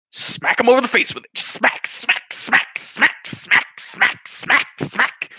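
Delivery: high, forceful rapid-fire smacks; relentless percussive hammering on one word; repeated smacking. Retold or imitated. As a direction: punch out each smack like a pan blow. Imitates repeated frying pan blows.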